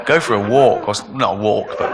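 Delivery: usual speaking voice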